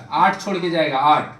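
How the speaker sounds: ordinary talk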